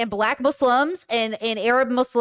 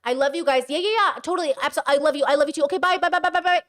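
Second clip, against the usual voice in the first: higher, bright chirpy goodbyes; sing-song family phone call; 4 semitones higher, faster than usual. Retold or imitated. Streamer acting out a cheery call with her family, high sing-song. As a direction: chirp the love-yous and byes like hanging up. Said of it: "the practice I've been doing".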